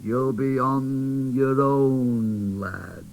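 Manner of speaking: slow, grave warning bear; teddy bear warning the boy doll, slow; doubtful teddy bear. Acted. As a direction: warn him kindly of dangers.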